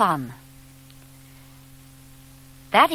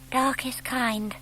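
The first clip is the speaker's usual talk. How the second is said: soft, slow mutter, slightly higher; muttered to himself; 5 semitones higher, 7 dB quieter, slower than usual. Acted. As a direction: reassure yourself under your breath. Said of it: "Plop muttered to himself".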